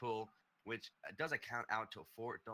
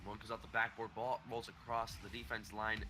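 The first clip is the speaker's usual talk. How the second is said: play-by-play; 4 semitones higher, 3 dB louder than usual. Acted. Live match commentary.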